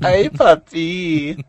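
high, slow, exaggerated 'ay, papi'; hammed-up Spanish accent. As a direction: ham it up like a telenovela. Echoing the phrase, hammed up.

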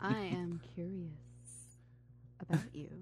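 breathy come-on; sultry murmur. Pretend. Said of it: "make it sound dirty".

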